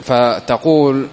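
usual speaking voice

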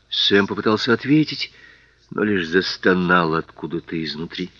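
usual speaking voice

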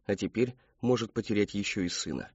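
plain reading voice

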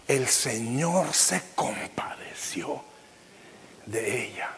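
hushed, slow whisper; whispered verse. Acted. Pastor reading the verse to the church, whispered. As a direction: let the words fall to a whisper.

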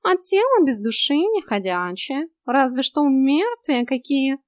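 high, slow cat voice; scornful talking cat. A narrator's reading. Cat lectures heroine on soulless bodies, high drawl. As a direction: explain as a cat, drawn out and high.

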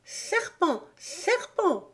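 high-pitched menacing pigeon; menace, pitched high without shouting; heavy menace. A narrator's reading. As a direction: accuse with threat in a high voice. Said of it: "répéta d'une voix lourde de menace".

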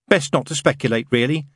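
a fussy angel stating a rule sincerely; prim angel. Acted. Angel telling the demon he can't do good, earnestly prim.

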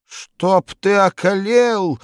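high, loud, drawn-out whimpering curse; loud slow whining old woman; whining wail. A narrator's reading. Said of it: "захныкала старушка".

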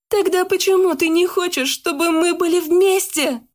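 high anguished cry; shouted. A narrator's reading. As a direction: cry out desperately. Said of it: "прокричала Яна".

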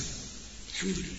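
soft, breathy whisper of gratitude; whispered thanks. Real, not acted. Preacher thanking God under his breath, whispered. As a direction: barely breathe the word.

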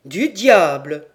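loud astonished oath; surprised oath. A narrator's reading. Captain stunned to find family in London, loud oath. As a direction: blurt it out loud in disbelief.

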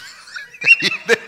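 big high burst of laughter; loud burst of laughter. Real, not acted. Laughs at own story.

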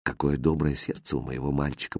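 very low, hushed musing; inner thought. A narrator's reading. Mother thinking fondly of her boy, hushed. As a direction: think it aloud, barely voiced. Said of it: "подумала Фатьма".

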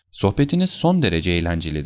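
usual speaking voice

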